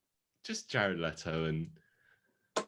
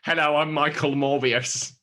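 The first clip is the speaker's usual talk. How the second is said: nerdy, high, loud mock greeting; dorky self-introduction; 7 semitones higher, 8 dB louder than usual. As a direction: introduce yourself like a dork. Mocking the dorky name.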